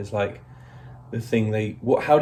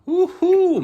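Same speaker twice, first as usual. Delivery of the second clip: excited whoop; 5 dB louder than usual. Real, not acted. Cheering while dirty under truck.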